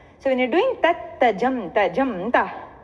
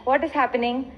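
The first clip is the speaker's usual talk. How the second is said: ordinary delivery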